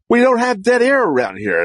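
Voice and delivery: a hint of a high bossy voice; mock boss. Radio boss warning the new host, lightly voiced. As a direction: play the boss laying down the rule.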